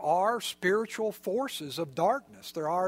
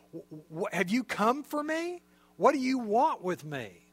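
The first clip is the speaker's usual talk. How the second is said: stammering, much higher, scared voice; frightened demon; 4 semitones higher than usual. Retold or imitated. Demons facing Jesus, stammering higher in fear. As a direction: stutter out the fear of being caught. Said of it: "In other words".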